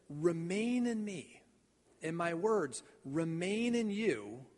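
slow, weighty stress on "Remain in me"; emphasis on remain. Shown as a demonstration. Preacher showing the right reading, slowly leaning on "Remain in me". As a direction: dwell on "Remain" and "remain in you", slowing right down. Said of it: "emphasize this".

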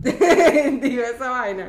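laughing through the words, slowly; real amused laughter. Real, not acted. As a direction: laugh it out at her own words. Laughs at herself.

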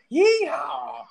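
high, loud cowboy whoop; Texan yeehaw. Pretend. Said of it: "I can do the Texas guy voice again".

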